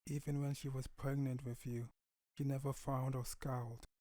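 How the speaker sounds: soft, hushed prayer to his late wife; grieving father. Acted. Father praying to his dead wife, soft and hushed. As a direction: hold back tears and murmur it softly. Father tells daughter about mother.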